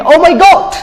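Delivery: high, loud burst of alarm; exclaiming. Shown as a demonstration. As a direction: cry it out as a shocked exclamation. Acting out meaning of oh dear.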